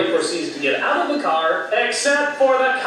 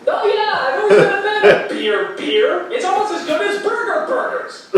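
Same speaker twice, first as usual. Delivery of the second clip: dopey bar patron; 11 semitones higher than usual. Retold or imitated. Voicing a beer-loving dumb patron.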